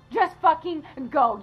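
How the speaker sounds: slow, high, bitten-off command; angry. Acted. Wife ordering her husband out, slow and angry. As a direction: spit each word at him.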